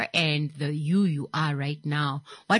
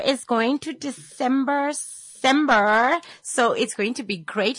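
carefree hype; 7 semitones higher, 3 dB louder than usual. Retold or imitated. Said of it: "you're like".

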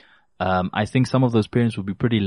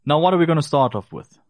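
ordinary talk, nothing marked

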